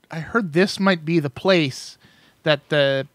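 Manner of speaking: usual speaking voice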